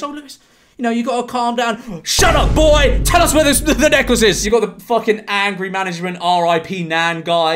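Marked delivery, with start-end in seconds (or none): 0.0-2.0 much higher, lightly mocking take on the bald man; a hint of the bald guy's fake calm. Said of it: "and he goes". 2.0-4.4 much higher, louder mock yell at the boy; imitated angry shout. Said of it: "and he goes".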